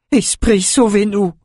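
high-pitched plea for rescue; villager's desperate, high appeal; frightened cry. Acted. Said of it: "cria la couturière".

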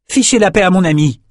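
high, loud outburst; defiant shout. A narrator's reading. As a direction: fling it at the king. Prisoner defends his friend.

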